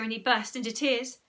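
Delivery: ordinary delivery, nothing marked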